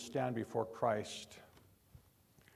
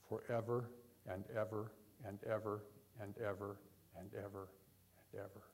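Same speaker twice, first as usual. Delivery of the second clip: lower, steadily fading to almost nothing; fading away; 3 semitones lower, 10 dB quieter, faster than usual. Real, not acted. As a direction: let each repetition recede further into the distance. Preacher dwells on endless eternity.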